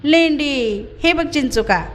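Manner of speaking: a lightly voiced boy crowing over his win; triumphant little shout; joyful shout. A narrator's reading. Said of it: "तो आनंदून ओरडला".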